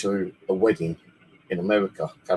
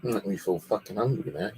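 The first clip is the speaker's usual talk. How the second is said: usual speaking voice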